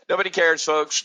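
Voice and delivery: fed-up shout at the audience; yelled refrain. Real, not acted. Ranting, repeats line then yells.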